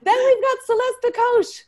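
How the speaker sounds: high, loud emcee call; excited introduction. Real, not acted. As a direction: belt out the next guest's name. Host announces the next guest.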